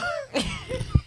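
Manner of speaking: very high squeal of laughter; soft, high-pitched cracking up; real laughter. Real, not acted. Friend hearing grandpa's warning, high helpless laughter.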